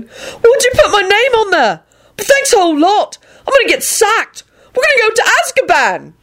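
very high, squawking outrage; panicked shouting. A narrator's reading. Neville blaming Lupin for their fate, panicked shout. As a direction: wail it in horrified protest. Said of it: "Neville shouted and turned on Lupin".

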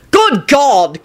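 high exasperated outburst; bellow. A narrator's reading. Boss appalled at Neville's flag, exasperated bellow. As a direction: exclaim it in appalled disbelief. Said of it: "Kingsley bellowed".

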